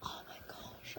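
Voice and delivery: a breathless whisper of wonder; awed whisper. Real, not acted. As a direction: whisper it, almost overcome. Overwhelmed by the gift.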